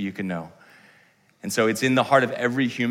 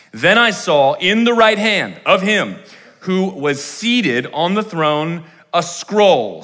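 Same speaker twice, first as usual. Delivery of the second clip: slow scripture reading; 6 semitones higher, 5 dB louder, slower than usual. Acted. Reads scripture slowly.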